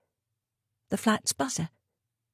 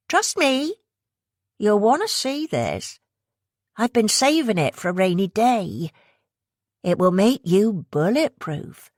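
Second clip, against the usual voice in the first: coaxing West Country woman; 5 semitones higher than usual. Acted. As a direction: tempt her in a West Country accent. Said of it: "the accent heavy West Country".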